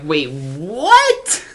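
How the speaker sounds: loud, rising mock shock; shocked disbelief. Vlogger reacting to the killed true love, loud mock shock. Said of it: "you're like".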